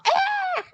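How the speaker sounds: very high short shriek; mock scream. Rat Boy screaming back in fright, a high shriek. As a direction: let out a quick startled scream. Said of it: "And then he screams too".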